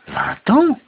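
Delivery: very high, loud rising question; astonished exclamation. A narrator's reading. Rip learning how long he was gone, astonished exclamation. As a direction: squeal it in disbelief. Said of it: "s'exclama Rip".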